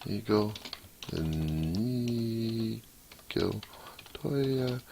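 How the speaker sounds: high, drawn-out name call, mock heroic; Inigo Montoya impression. Coder savours the name, lightly hammed-up Inigo. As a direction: stretch the name like a bad hero. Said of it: "Such a wonderful performance of Inigo Montoya".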